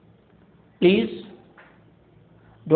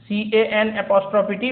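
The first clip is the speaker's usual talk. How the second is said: usual speaking voice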